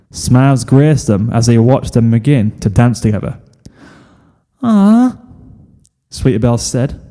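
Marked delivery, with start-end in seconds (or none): 4.6-5.2 swooning aww. Acted. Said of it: "the girls all went".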